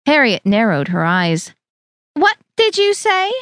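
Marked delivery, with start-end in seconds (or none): 2.1-3.3 high, sharp, offended question; Harriet's voice. A narrator's reading. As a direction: demand it high and affronted. Harriet bristling at remark.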